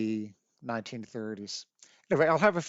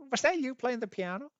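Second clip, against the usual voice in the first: higher-pitched, curious question; retold eager question, pitched up; curious inquiry; 10 semitones higher, faster than usual. Visitor asks Grandma about the piano music, curious.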